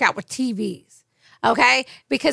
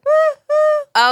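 high, loud woo-hoo; cheering whoop; 6 dB louder than usual. Real, not acted. Co-host cheering after the song, excited whoop. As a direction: whoop with joy.

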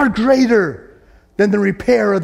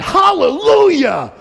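high, loud, drawn-out shout; shouted hallelujah; 10 semitones higher, 5 dB louder, slower than usual. Retold or imitated. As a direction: shout it out in joy. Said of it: "they shouted".

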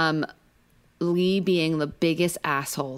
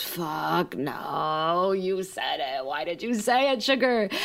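collective dismay; much slower than usual. Retold or imitated. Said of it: "Everyone around the table goes".

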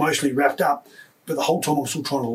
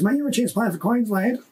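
put-on higher, changed voice of an old boxing coach; coach's voice; 7 semitones higher than usual. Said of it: "He goes".

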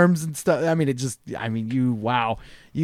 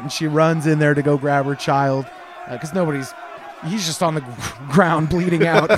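ordinary talk, nothing marked

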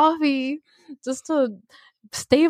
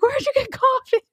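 much higher, put-on asking voice; imitating coworkers; 12 semitones higher than usual. Coworker mimics everyone asking her, in a high put-on voice. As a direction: squeak it like everyone pestering her.

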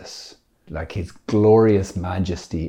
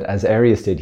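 usual speaking voice

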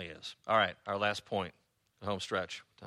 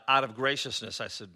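ordinary delivery

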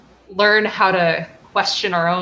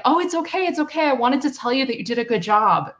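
reassuring teacher; 7 semitones higher, faster than usual. Retold or imitated. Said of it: "she was saying".

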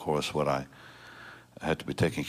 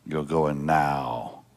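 very slow, deliberate retold command; firm order; 3 semitones lower, much slower than usual. Strong daughter insisting to her father, slow firm command. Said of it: "she's always been strong".

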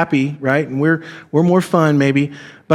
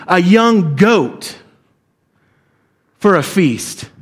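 bitter slow accusation; slower than usual. Acted. Older son accusing his father, slow and bitter. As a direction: drag out each phrase slowly with resentment. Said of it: "talked to his dad like this".

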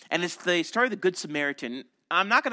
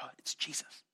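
awed whisper; 4 semitones lower, 16 dB quieter than usual. Retold or imitated. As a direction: whisper it as he appears, barely a breath. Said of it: "and I was whispering".

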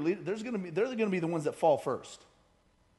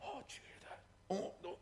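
mock gossipy whisper behind someone's back; very soft, voiceless crowd whisper; 21 dB quieter than usual. Crowd passing gossip behind the speaker, whispering. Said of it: "they kinda whisper behind".

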